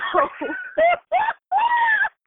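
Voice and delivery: a loud, high burst of real laughter; loud laughing howl. Real, not acted. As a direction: crack up loudly. Reacting to her own joke.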